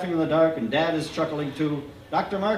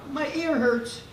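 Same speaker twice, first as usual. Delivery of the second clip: lightly voiced timid little boy, high; small unsure kid's answer; 10 semitones higher, slower than usual. A narrator's reading. Sick boy answering the doctor, lightly timid.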